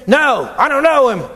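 high, anxious denial; Peter denying. Acted. Peter denying Jesus to a servant woman, high and tense. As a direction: blurt it out quickly to get away.

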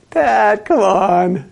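loud, high groan at a dad joke; hammed-up groaning complaint, pitched high and loud; exasperated groan. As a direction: groan it out in mock protest. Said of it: "you just kinda groan a little bit".

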